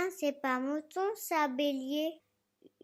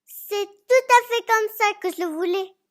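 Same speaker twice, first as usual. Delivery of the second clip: put-on high, loud, thrilled little boy; delighted child; 10 semitones higher, 10 dB louder than usual. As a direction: burst out with joy. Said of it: "s'illuminer le visage".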